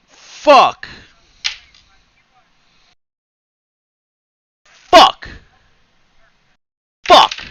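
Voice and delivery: screaming at the broken pen; real frustrated shouting. Real, not acted. Streamer discovers broken vape, real anguished shouts.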